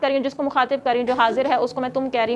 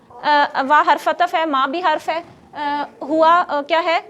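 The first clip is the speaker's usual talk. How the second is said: normal talking voice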